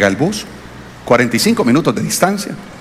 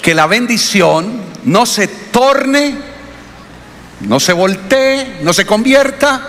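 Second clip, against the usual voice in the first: slow emphatic; 5 semitones higher, much slower than usual. Real, not acted. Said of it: "sermon point".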